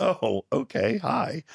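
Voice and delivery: high, flustered "oh, okay, hi"; surprised hi. Retold or imitated. Narrator answers an unexpected call, high and surprised. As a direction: pop up high, caught off guard. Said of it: "And I was like".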